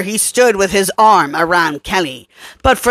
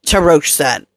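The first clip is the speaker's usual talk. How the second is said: ordinary delivery, nothing marked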